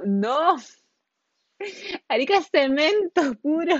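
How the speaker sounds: laughing reply. Retold or imitated. Correcting him about Arica, laughing loudly. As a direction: blurt it out laughing. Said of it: "y yo como".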